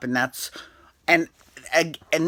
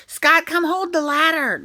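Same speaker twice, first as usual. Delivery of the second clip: shrill high mock whine, much higher; mocking Brooke; 14 semitones higher, 4 dB louder than usual. Mocking Brooke calling Scott to hold the ladder, high whine. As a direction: whine it like a bossy partner. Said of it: "she's like".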